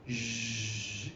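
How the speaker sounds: "sh" held long with voice, as zh; long, low, steady voiced "sh"; drawn-out sound. Shown as a demonstration. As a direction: sustain a soft buzzing "sh", voiced like zh. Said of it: "these are long".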